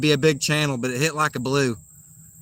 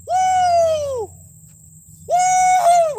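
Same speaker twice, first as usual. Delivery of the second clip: high loud joyful whoop; excited whoop; 7 dB louder than usual. Real, not acted. Fish hooked, cheering.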